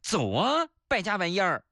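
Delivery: mildly hammed-up scolding; mock roar. Nephew scolding uncle after paying for his breakfast, mock roar. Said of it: "吼道".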